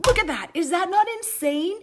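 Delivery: can't contain the thrill, pitch jumps up; excited. Real, not acted. Showing viewers a new stamp set, thrilled gush.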